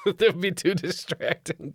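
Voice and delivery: high-pitched mock worry; mocking echo. Mockingly echoes Cameron's reported worry.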